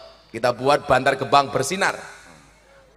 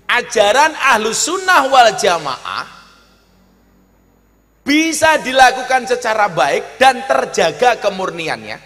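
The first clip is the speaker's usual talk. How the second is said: high, loud, stretched-out declamation; forceful oratory; 10 semitones higher, 8 dB louder, slower than usual. Real, not acted. Preacher declaring duties to the congregation, forceful oratory. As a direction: proclaim it to the whole crowd.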